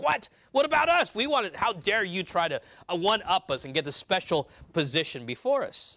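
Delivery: imitating the jealous disciples' protest; indignant protest. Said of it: "And so they're all upset".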